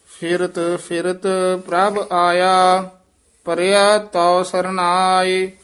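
measured, level intoned Gurbani chant; scripture recitation. Acted. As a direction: intone each phrase evenly, drawing out the endings.

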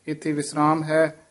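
ordinary talk, nothing marked

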